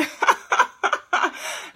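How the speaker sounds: a high, airless burst of laughter; high breathy laugh. Real, not acted. Laughing at her own bae issues to viewers.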